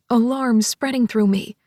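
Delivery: ordinary delivery, nothing marked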